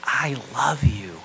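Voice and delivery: slow, loud and heartfelt; a full-weight declaration of love; tender declaration. Shown as a demonstration. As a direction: say it slowly like a vow. Preacher voices key gospel words.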